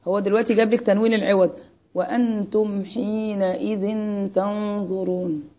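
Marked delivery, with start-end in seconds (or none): 1.9-5.5 very slow, drawn-out recitation; measured recital with long held vowels; Quranic recitation. Shown as a demonstration. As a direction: stretch out each word of the verse. Reciting a Quran verse as example.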